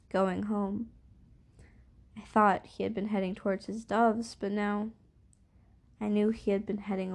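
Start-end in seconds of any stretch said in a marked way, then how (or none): none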